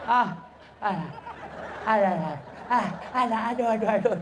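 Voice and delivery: mock yelps of a bitten mother; acted pain. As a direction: play-act wincing while breastfeeding. Mother bitten while breastfeeding. (pura-pura mengaduh kesakitan)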